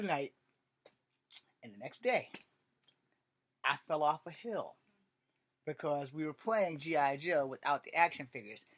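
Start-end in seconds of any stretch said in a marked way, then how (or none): none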